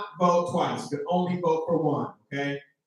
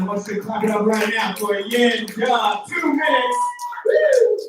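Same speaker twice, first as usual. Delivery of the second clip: hype announcer; 4 semitones higher, 6 dB louder than usual. Real, not acted. Host hyping crowd.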